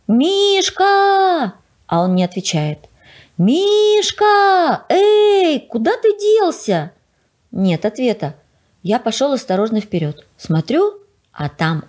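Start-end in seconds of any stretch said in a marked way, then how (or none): 0.1-1.5 громкий высокий протяжный зов «Мишка!»; крикните «Мишка!» далеко в лес; зов по имени. A narrator's reading. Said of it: "Я кричу". 3.4-6.9 громко и высоко зовёт «Мишка! Эй»; кричите в темноту, ища друга; встревоженный зов. A narrator's reading.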